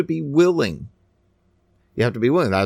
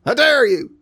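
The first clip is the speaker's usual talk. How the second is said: mock indignation; 10 semitones higher, 3 dB louder than usual. Mocking an offended fool.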